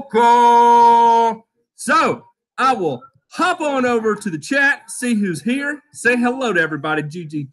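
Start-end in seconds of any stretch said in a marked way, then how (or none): 0.0-1.5 louder, drawn-out announcer call. Acted. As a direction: boom it out like a game show host. Host announces roll call segment.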